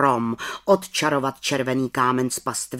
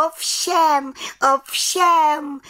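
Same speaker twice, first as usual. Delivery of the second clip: high, eager little witch's call; 5 dB louder, much slower than usual. A narrator's reading. Little witch confirming to the council, calling out. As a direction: cry it out high, twice over. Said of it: "volala malá čarodějnice".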